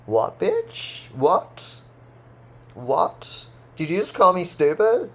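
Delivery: high, incredulous what, what, rising; playing outraged at a hater's insult; hammed-up disbelief with rising questions; mock outrage.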